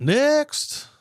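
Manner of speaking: high sung-out call of next; mock announcer next. Real, not acted. Host calling for the next clip, high and bright.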